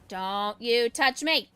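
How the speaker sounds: slow, loud threatening warning; mock warning shout. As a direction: warn him off dramatically. Enemy closing in.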